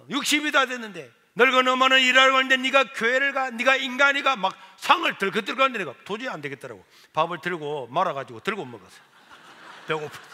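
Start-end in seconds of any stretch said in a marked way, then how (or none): 0.1-4.4 louder, higher scolding; acted indignant tirade; mother's angry reproach. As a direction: berate him like a wronged mother. Weeping mother berates son at table.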